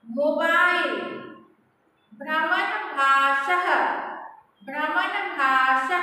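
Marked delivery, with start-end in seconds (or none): 2.1-6.0 पत्रभारः drawn out slowly, final ह sounded; slow model word. Shown as a demonstration. Teacher drills पत्रभारः slowly for students to echo. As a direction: stretch पत्रभारः for repetition. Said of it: "you pronounce with me".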